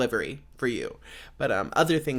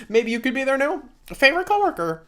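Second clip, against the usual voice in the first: higher, laughing through the words; 11 semitones higher, 5 dB louder than usual. Real, not acted. Roasting old coworkers to the listener, laughing. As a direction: giggle the line out.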